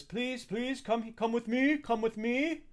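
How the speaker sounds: high-pitched, courteous servant guiding guests; high formal helper's voice, pausing between phrases; eager servant Adjunct. Acted. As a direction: play an obliging attendant, pitched well up. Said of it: "And Adjunct says".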